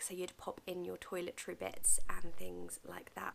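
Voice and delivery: ordinary delivery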